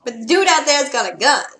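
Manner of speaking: loud, indignant exclaim; loud protest. Real, not acted. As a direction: shout your protest in disbelief. Protesting to game character.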